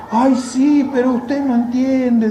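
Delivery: high, loud protesting woman; a churchwoman brushing off the preacher, high and insistent; mimicking the sister protesting.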